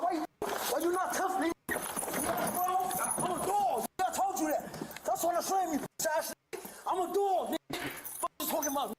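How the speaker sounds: high-pitched, furious taunts; defiant ranting. Real, not acted. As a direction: rant at the officers, voice kept high. Suspect rants and taunts officers.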